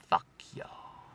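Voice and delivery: mock-whispered kiss-off; whispered dismissal. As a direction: whisper it as the departing driver. Quoting Max's imagined parting words.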